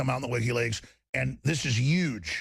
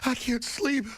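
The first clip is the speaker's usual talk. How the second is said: high cracking fake-crying voice; fake-crack Michelle; 9 semitones higher, much slower than usual. Mocking Michelle Obama scared of Trump, high fake-crying crack. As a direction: play up a phony tearful crack, pitched high. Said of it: "fake crack in the voice".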